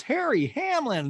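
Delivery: high drawn-out excited greeting; Garcelle impression. Garcelle greeting Harry, high slow gushing call. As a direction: call the name high and stretched. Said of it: "And Garcelle goes".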